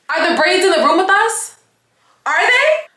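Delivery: very high, loud squeal of disbelief; high-pitched sarcastic questioning; mock incredulous. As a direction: ask it in a squeaky, exasperated high voice. Mock outburst over missing braids.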